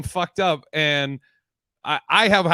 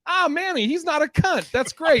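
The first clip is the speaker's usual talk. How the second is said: high, bright mock praise, much higher; mock cheerful praise; 12 semitones higher than usual. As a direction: chirp it out in a high sing-song. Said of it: "you'll be like".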